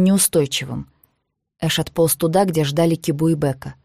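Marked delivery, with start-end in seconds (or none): none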